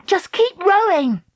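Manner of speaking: high, urgent command; urgent cry. A narrator's reading. Dakota urging Treacle on among the eels, urgent cry. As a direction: cry it high, driving them on. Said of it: "Dakota cried".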